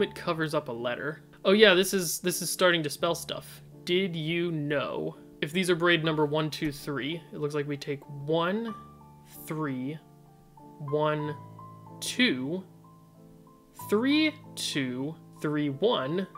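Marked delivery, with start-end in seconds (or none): none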